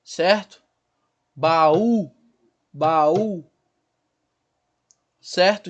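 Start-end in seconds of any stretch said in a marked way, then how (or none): 1.3-3.5 "Baú" stretched as ba-Ú, stress on U; hiatus demo. Shown as a demonstration. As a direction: separate A and U in "Baú". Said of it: "Então eu tenho duas sílabas aí". ("Baú" em duas sílabas marcadas)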